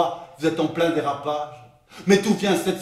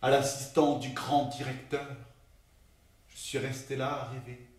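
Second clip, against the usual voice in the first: very soft, lower-pitched musing; hushed confession; 4 semitones lower, 9 dB quieter than usual. Acted. Assistant confessing a secret daydream, hushed. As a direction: confide it quietly, almost to yourself.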